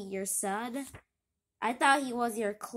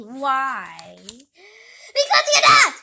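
shrieking retort; 15 dB louder, much slower than usual. Acted. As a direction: scream the comeback. Kid shouts back in argument.

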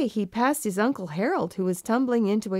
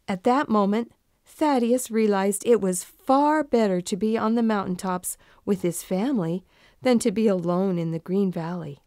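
usual speaking voice

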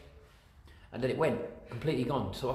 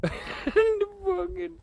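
nervous laugh; 4 dB louder, much slower than usual. Real, not acted. Laughing in fright after knock.

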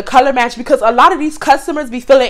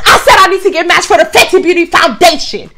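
higher, louder, snippy repeat; rude raised voice; 6 semitones higher, 3 dB louder than usual. Retold or imitated. Customer snapping her request again, higher and louder. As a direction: throw it back at the clerk with attitude. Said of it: "she said it just like that".

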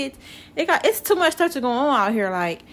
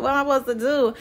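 normal talking voice

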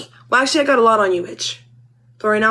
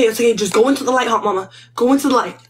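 usual speaking voice

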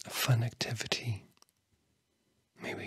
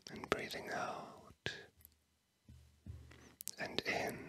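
very soft whisper with long pauses; barely whisper, guiding each breath toward sleep; 10 dB quieter than usual. Real, not acted. Guiding a sleepy listener's breathing, in a very faint whisper. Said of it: "last few words that I'm whispering".

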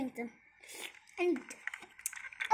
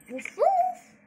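high, loud pretend dog bark; child's high woof-woof for the toy dog; 12 semitones higher, 6 dB louder than usual. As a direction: yap like a small toy dog. Dog doll barks.

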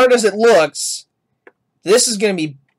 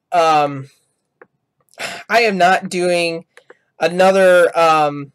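usual speaking voice

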